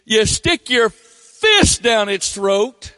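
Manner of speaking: rising incredulity. Real, not acted. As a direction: paint it as crazier and crazier. Describing catfish noodling incredulously.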